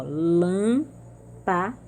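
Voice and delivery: lâmpada very slowly, split as LÂM, PA, DA; sílabas separadas. Shown as a demonstration. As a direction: pause between each syllable of lâmpada. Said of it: "são as antepenúltimas". (lâmpada bem devagar: LÂM... PA... DA)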